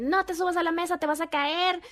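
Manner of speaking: high-pitched parental warning; alarmed scolding. Shown as a demonstration. Parent warns child climbing on the table, alarmed scolding. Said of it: "en lugar de decirle".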